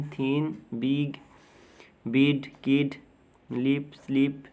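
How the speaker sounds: word-list demo, ई sound in बिग, लिप, each word set apart. Shown as a demonstration. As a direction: say इट, फिट, हिट with an ई vowel. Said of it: "अब आई का उच्चारण ई की तरह". (इट, फिट में आई को ई की तरह बोलें)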